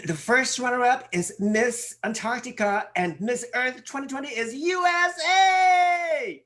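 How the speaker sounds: hammed-up pageant crowning announcement; pageant host announcement. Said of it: "Okay, let me, like".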